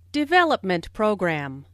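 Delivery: "Development program" as a compound, first word stronger; stress on DEVELOPMENT. Shown as a demonstration. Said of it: "the main stress falls on development".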